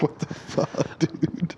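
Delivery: whispered mock shock. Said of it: "He's like".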